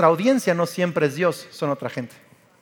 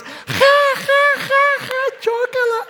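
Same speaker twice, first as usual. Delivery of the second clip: mock laughing brothers; much slower than usual. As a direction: ham up the brothers' cackle. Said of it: "y sus hermanos". (los hermanos celebran, risa aguda exagerada)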